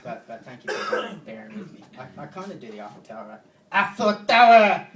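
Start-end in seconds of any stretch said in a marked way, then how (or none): 3.7-4.9 put-on very loud, high, drawn-out French-accented yell; mock French yell. French guys saying Eiffel Tower, mock French yelling. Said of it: "they yell it every time they say it".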